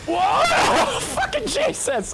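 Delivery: loud, high-pitched yelp of shock; startled yell. Real, not acted. Gamer hit twice in a row, startled yell. Startled by taking two hits.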